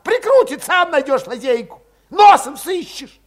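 high, loud, irritated shouting; angry outburst. A narrator's reading. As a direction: throw it back at him in a high yell. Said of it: "окрысился вдруг Валет".

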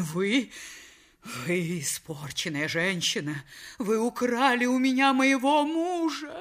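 slow, halting, rising to high wail; wronged wife's accusation. Acted. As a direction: accuse your rival, building in outrage. Wronged wife accuses her rival. (возмущённо, с нарастающим надрывом)

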